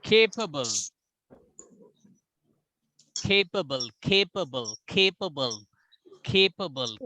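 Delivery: capable with a strong aspirated K on the first syllable; stress on K. Shown as a demonstration. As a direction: hit the K of capable hard. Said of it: "K will be having more emphasis".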